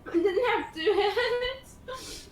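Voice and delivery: high, slow laughing protest. Real, not acted. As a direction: giggle through the denial.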